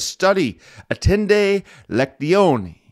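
put-on Latin in a light Italian accent, high and slow: "attende lectione". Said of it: "say my Latin with a little bit of an Italian, uh, accent".

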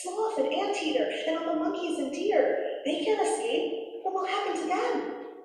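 high-pitched storybook parrot; worried little parrot. A narrator's reading. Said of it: "said the parrot".

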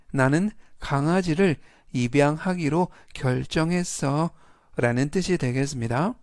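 usual speaking voice

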